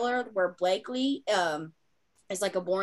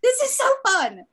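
squealing high and loud with glee; gushing excitement; 7 dB louder than usual. Retold or imitated. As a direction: gush like a fangirl over the scene. Gushing about makeout scenes.

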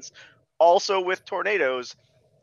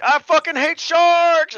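much higher, louder, slow hammed-up battle cry; mock yell; 11 semitones higher, 8 dB louder, slower than usual. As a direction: scream it like a hammed-up movie hero. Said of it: "she's like".

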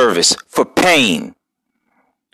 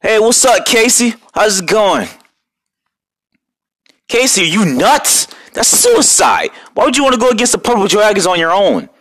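alarmed friend; 7 semitones higher than usual. Acted. Alarmed at Casey's plan.

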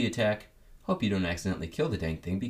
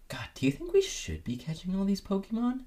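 much higher, anxious NPC voice; worried character voice; 13 semitones higher than usual. Retold or imitated. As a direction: fret like a nervous sidekick. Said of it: "a character would be like".

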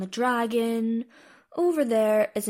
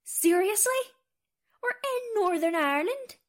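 high-pitched, incredulous repeat; exasperated, pitched right up with a rising end; exasperated disbelief; 10 semitones higher than usual. Acted. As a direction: answer as if you can't believe they asked. Asked yet again where they are.

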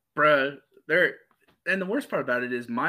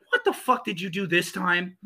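exasperated mom; 5 semitones higher than usual. Retold or imitated. Mom's exasperated reaction, acted.

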